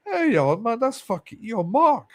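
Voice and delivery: high-pitched mock call-out to an old acquaintance; retold surprised recognition. Man retells recognising a schoolmate who followed him, high-pitched surprise.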